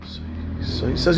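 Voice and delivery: usual speaking voice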